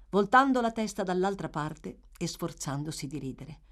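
usual speaking voice

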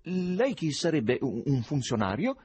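slow, hesitant wary question; suspicious. A narrator's reading. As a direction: probe slowly, stumbling on the guess. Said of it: "disse con sospetto". (domanda sospettosa, esitante)

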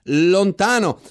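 ordinary delivery, nothing marked

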